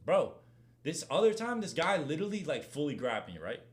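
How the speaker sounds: ordinary talk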